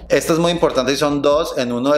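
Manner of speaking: ordinary talk, nothing marked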